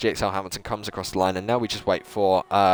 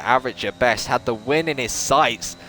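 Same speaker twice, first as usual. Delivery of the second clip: higher, lively race commentary; live play-by-play; 5 semitones higher than usual. Acted. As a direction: call the lap for the viewers.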